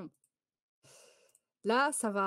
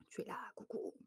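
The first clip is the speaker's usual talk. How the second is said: whisper; 12 dB quieter, faster than usual. Real, not acted. Whispered hello to a viewer.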